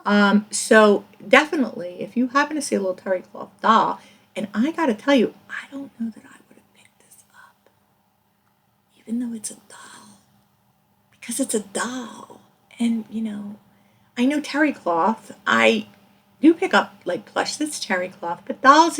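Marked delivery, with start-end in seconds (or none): 5.5-13.6 whisper. Real, not acted. Confiding aside about the doll.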